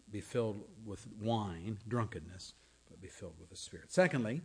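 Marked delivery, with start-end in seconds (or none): none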